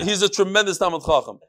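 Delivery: ordinary talk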